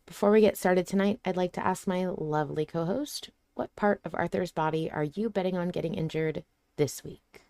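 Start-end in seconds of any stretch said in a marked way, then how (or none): none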